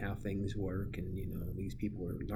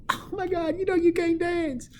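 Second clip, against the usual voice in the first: very high, loud falsetto shriek; exaggerated squealing pupil imitation; mocking student; 10 dB louder than usual. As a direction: shriek it like an appalled kid. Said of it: "And then you'd go".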